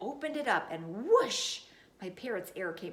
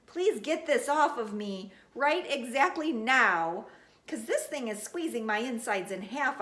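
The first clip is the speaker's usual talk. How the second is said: urgent plea; 4 semitones higher, 6 dB louder than usual. Acted. Begging to get ring off.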